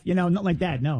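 ordinary talk, nothing marked

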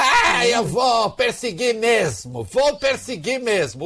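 high, drawn-out mock shout; exaggerated mimicry, pitched well up; Eduardo's defiant vow. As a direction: imitate a hammed-up threat, stretching the words. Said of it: "nós falamos sobre o Eduardo".

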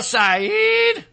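SAYED stretched long, jumping high at the end; drawn-out name. Real, not acted. Host naming the senate candidate, drawn-out mocking stress. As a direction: drag out "Sayed" with a rising lilt.

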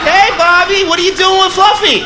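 high, loud shout to a misbehaving son; parent calling out. Acted. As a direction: call out to the kid in alarm.

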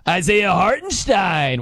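drawn-out OKC announcer call; arena announcer. Retold or imitated. Host mimicking the OKC announcer's player call, drawn-out announcer voice. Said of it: "that's how the O K C announcer does it".

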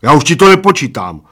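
stubborn. A narrator's reading. Bald man refusing to keep time, stubborn. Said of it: "oznámil plešatec umíněně".

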